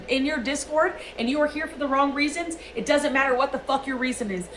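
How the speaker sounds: usual speaking voice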